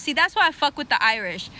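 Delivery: ordinary talk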